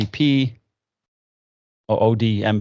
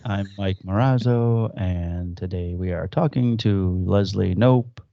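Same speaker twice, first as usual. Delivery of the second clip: slow, drawn-out mock radio-show introduction; buttery smooth radio host; 3 semitones lower, slower than usual. Imitating the sitcom's radio host to open an interview, buttery smooth. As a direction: imitate a smooth public radio presenter, taking your time. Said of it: "Buttery smooth voice".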